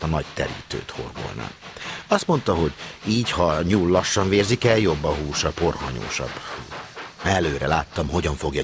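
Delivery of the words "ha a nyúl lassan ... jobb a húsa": plain reading voice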